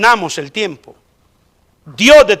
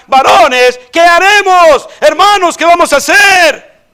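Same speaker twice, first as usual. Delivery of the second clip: much higher and louder, pleading; anguished listeners pleading with the apostles; desperate crowd; 8 semitones higher, 5 dB louder than usual. Acted. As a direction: cry out as a crowd begging for an answer. Acting out the crowd's desperate question.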